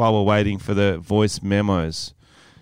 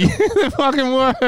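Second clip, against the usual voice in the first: laughing; 14 semitones higher than usual. Real, not acted. Laughs about the prank.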